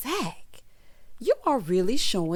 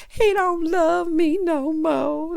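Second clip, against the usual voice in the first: high, slow, drawn-out lament; hammed-up wailing over lost love; mock heartbroken wail; 8 semitones higher, 4 dB louder, much slower than usual. Imitates film character's heartbroken wail.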